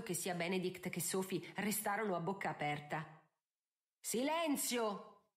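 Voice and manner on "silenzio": slow, raised call for silence; commanding. A narrator's reading. Judge silencing two quarrelling ladies, commanding. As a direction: stretch the word into an order. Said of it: "intimò il giudice".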